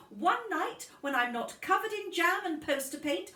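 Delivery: fed-up mum venting; exasperated mother. Acted.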